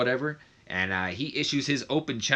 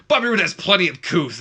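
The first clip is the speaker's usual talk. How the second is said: higher, louder mock proud declaration; imitating Corey Graves; 8 semitones higher, 8 dB louder than usual. Commentator defending Bobby Roode's manners, loud higher mock boast. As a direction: insist on it with puffed-up pride. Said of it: "Corey Graves is all like".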